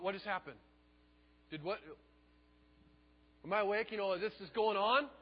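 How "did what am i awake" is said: ordinary talk, nothing marked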